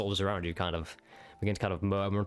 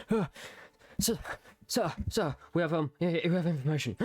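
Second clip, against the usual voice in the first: high, breathless and urgent; panting messenger; 9 semitones higher than usual. Acted. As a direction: gasp it out like you ran all the way. Exhausted soldier reporting to camp.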